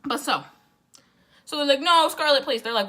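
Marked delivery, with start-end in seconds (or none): 1.8-2.6 higher, louder imitation of begging; mock pleading. Twins begging Scarlett to stay, mock pleading. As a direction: beg in an acted, playful plea.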